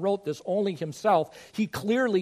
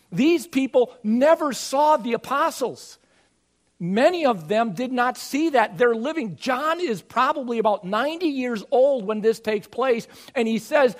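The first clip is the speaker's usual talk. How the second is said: higher and louder, building pulpit fervour; impassioned preaching; 5 semitones higher, 5 dB louder than usual. Acted. Pastor urging congregation that fellowship is real, preaching fervently. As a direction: preach it out with rising conviction.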